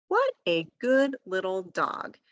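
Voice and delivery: put-on careful, measured reading for a young child; each word set apart and clearly said; slow and clear. Shown as a demonstration. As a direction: dictate it slowly, word by word. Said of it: "read the sentence to them first slowly and clearly".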